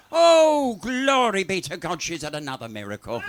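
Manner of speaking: loud, high "oh" dropping into a quick laughing line; mock pious exclamation. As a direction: play the innocent saint. Old lady feigns a miracle.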